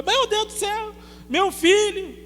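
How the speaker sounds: high, loud frantic wail for her son; panicked cry. Retold or imitated. Terrified mother on the plane crying for her son. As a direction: cry out in panic over the child.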